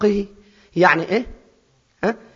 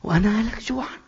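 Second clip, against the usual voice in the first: hushed; 5 semitones higher, 6 dB quieter than usual. Shown as a demonstration. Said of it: "شوف التفكير".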